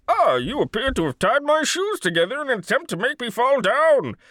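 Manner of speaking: hammed-up supervillain declaiming; Bane. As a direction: rise from pompous puzzlement to booming threat. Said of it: "he'd be like".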